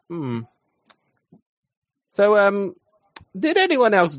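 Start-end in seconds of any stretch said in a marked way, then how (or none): 0.1-0.4 low, soft "hmm"; pensive hum. Acted. Player's character sitting in the sunbeam, low pensive hum. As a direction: hum it quietly, pitched well down. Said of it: "Yeah, I'm like".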